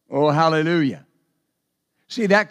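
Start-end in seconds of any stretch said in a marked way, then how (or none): none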